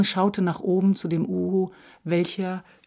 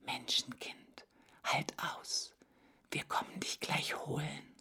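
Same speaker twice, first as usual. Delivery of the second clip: soft, breathy whisper, much softer; 15 dB quieter than usual. Acted. Hare silently promising the lost boy, whispered. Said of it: "flüsterte er".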